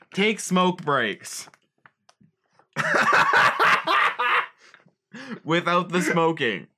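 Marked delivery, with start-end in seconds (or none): 2.7-4.5 loud squealing laugh; high burst of laughter. Real, not acted. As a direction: crack up high. Big laugh after joke.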